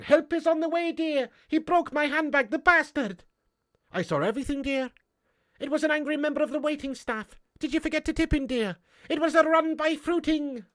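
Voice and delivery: put-on high-pitched, sing-out old nanny voice; Mrs. Doubtfire. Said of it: "Missus Doubtfire's an epic movie".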